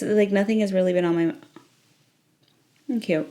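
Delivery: usual speaking voice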